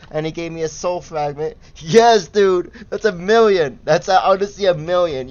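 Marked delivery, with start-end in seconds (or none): 1.8-5.3 high and loud celebration; excited. Real, not acted. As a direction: shout it like you just hit the jackpot. Rare drop celebration.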